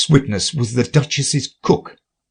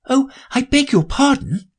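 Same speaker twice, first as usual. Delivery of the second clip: much higher, dismayed apology; great dismay. Acted. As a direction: gasp it out, flustered. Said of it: "she exclaimed in a tone of great dismay".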